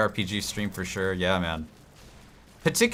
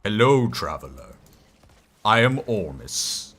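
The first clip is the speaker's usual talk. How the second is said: Ormus voice; 2 semitones lower than usual. Pretend. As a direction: greet like a cryptic wizard. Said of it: "I'll do all the voices".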